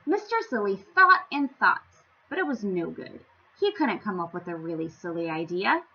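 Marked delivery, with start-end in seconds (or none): none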